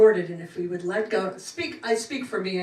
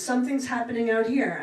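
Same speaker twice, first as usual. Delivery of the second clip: ordinary delivery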